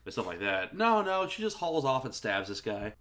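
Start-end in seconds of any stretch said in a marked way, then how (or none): 0.8-1.3 NO, NO jumps high and loud; emphatic, raised-pitch contradiction; emphatic correction. Real, not acted. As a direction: cut in high and loud on both NO's. Emphatic correction of expected poisoning.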